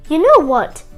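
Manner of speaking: plain reading voice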